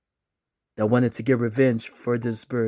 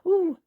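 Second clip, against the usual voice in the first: very high falsetto "ooh"; wife's "ooh". Acted. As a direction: squeal a small, high "ooh". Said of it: "she's like".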